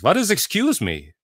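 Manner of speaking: punchline in a put-on Israeli accent. Israeli answering a pollster at the punchline, baffled Israeli accent. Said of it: "the Israeli says".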